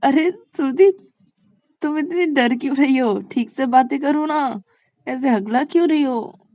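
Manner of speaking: high, loud incredulous questioning; amused teasing. Acted. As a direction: tease the stammerer at full volume. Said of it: "Shreya जोर-जोर से हंसने लगती है".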